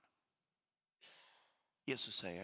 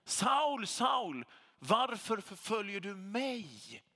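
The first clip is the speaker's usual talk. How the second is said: loud, higher ringing call, slowing on the question; calling voice of Jesus; 7 semitones higher, 6 dB louder, slower than usual. Acted. Jesus calling out to Saul, loud and high. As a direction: call out the name like a voice from heaven. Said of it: "Jesus som talar till honom och säger".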